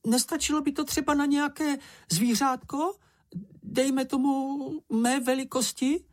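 very high-pitched little character; high, angling question; Čenich's hopeful plea. A narrator's reading. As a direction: ask hopefully in a tiny high voice. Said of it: "zeptal se Čenich".

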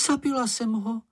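high-pitched dismay; horrified cry. A narrator's reading. Slečna Ferka thinking she killed Mumínek, horrified cry. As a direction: exclaim it high, aghast. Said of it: "vykřikla".

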